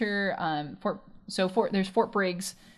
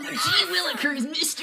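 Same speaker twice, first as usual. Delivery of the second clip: put-on high, eager kid shouting with a laugh; wholesome 1950s kid; 8 semitones higher than usual. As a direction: gush like a starry-eyed little boy. Mimicking the kid's eager voice.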